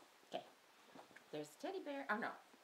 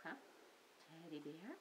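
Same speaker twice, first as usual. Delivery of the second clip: ordinary talk, nothing marked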